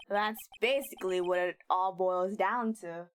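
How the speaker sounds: a hint of the gruff detective, New York tinge; mild New York cop accent for the detective; Gumshoe accent. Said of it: "my Gumshoe accent".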